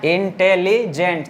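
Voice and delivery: Intelligent slowly in four linked beats; measured four-part intelligent; syllable by syllable. Shown as a demonstration. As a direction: say intelligent as इन-टे-ली-जेंट. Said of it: "चार syllable हो जा रहा है".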